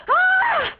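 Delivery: sharp high shriek of frustration; teen shrieks at her parents; frustrated shriek. Acted. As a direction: let out a short furious squeal. Frustrated outburst.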